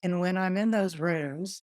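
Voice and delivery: ordinary talk, nothing marked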